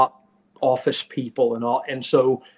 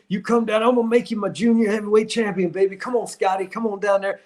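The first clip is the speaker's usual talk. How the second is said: Dusty's pitch; 5 semitones higher than usual. Retold or imitated. Retelling Dusty Rhodes's pitch.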